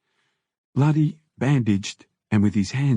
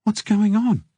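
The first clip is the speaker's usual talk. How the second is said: higher, anxious woman's voice; pressing him for answers; worried Mimi; 11 semitones higher than usual. A narrator's reading. Wife on the phone demanding to know, anxious higher voice.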